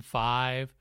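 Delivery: normal talking voice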